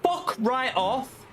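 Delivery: high-pitched angry swearing; swearing tirade. Real, not acted. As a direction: rage at the other drivers. Crashed in race, raging at others.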